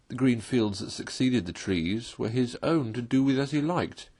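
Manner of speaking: ordinary delivery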